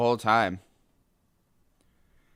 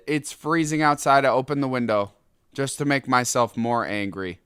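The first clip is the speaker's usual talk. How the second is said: ordinary talk, nothing marked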